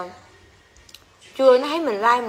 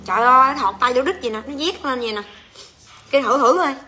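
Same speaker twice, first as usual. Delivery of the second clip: normal talking voice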